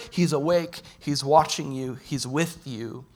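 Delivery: normal talking voice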